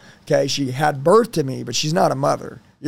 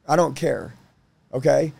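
ordinary talk

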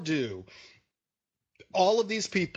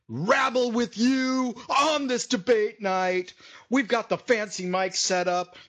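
stretched-out, higher show-intro boom; hammed-up announcer; 6 semitones higher, 3 dB louder than usual. Co-host introduces himself to debate-night listeners, hammed-up announcer. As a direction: draw it out like a mock ring announcer.

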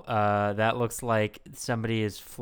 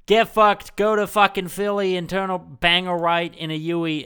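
loud, higher-pitched mock rude controller; obnoxious asshole; 7 semitones higher, 5 dB louder than usual. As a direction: imitate an abusive American controller, pitched up and loud. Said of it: "they talk like assholes".

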